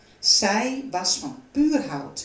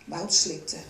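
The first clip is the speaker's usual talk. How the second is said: ordinary delivery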